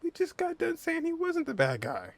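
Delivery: incredulous high-pitched objection to a game character; exasperated retort. Real, not acted. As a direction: squeak it out in disbelief, pointing out the contradiction. Arguing back at Paimon's claim.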